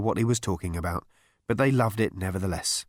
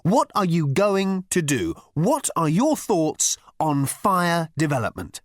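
higher, slow, emphatic grilling; rounding on him; 7 semitones higher, 3 dB louder, slower than usual. A narrator's reading. Ford questioning the hairdresser, rounding on him. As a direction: demand answers point by point. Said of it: "rounding on him".

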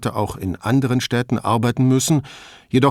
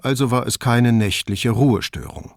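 plain reading voice

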